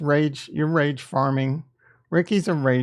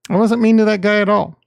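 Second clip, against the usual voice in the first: louder, fast, emphatic denial; insistent protest; 5 semitones higher, 5 dB louder, faster than usual. Real, not acted. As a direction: push back hard on the accusation.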